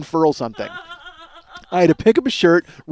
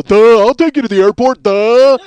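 loud, high dumb-guy voice; hammed-up "duh" doofus offering help; mock eager dope; 6 semitones higher, 10 dB louder than usual. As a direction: play a lovestruck oaf, loudly. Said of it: "trip on my dick and just go".

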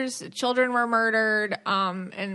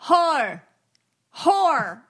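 mock Long Island accent; 5 semitones higher, 4 dB louder than usual. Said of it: "you're like".

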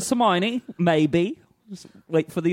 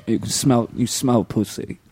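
low, slow mock rocker; lightly British rock star; 5 semitones lower than usual. [name] doing an eighties British rock star, low and slow. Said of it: "[name] doing nineteen eighties British rock star".

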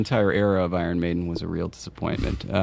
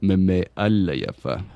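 slow, careful syllables; "Meme Allayafa" said slowly, syllable by syllable; slower than usual. Shown as a demonstration. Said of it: "Syllable by syllable".

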